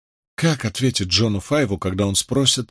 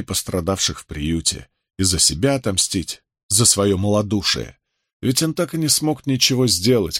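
usual speaking voice

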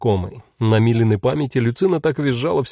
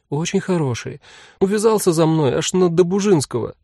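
put-on higher girl's voice, eager and pleading; excited little girl's voice; young girl pleading; 6 semitones higher than usual. A narrator's reading. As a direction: talk fast to win over mum.

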